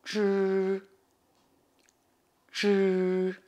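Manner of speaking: "zhi" as a held retroflex buzz; curled-tongue zhi. Shown as a demonstration. Teacher demonstrates the sound to learners, "zhi" drawn out with curled tongue. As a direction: model "zhi" long, each alone. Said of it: "Et le Z I".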